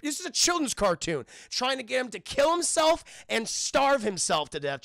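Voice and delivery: real amused outrage; incredulous. Real, not acted. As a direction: protest how dark this plot is. Streamer astonished at dark plot.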